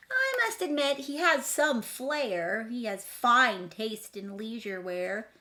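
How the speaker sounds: high, loud, sing-song rhyming read; dramatic storybook reading. A narrator's reading. Reading the picture book to children, dramatically. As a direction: read the rhyme big for kids.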